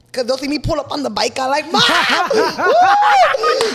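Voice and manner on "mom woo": loud, high call with a laugh. Retold or imitated. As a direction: shout for mom across the street. Calling out to mom.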